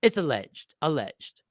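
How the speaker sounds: "alleged" said low and dry, with a wink; tongue in cheek alleged. Shown as a demonstration. Mocking the legal hedge to co-hosts, dry and knowing. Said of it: "I said cheek and tongue".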